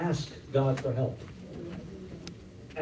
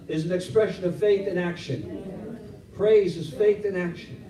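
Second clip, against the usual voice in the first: louder, higher preaching; full-force preaching; 3 semitones higher, 4 dB louder than usual. Real, not acted. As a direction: preach it to the rafters. Preaching climax.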